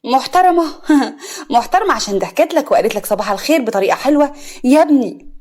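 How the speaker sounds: loud, scornful mother with a scoffing laugh; sharply mock the word, then press the warning. A narrator's reading. Mother warns son the girl will steal him, sharp. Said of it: "فوزية بحدية".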